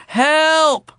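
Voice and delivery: high, loud fake plea; wailed cry for help. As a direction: yell for help, overacted. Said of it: "Charlie wailed".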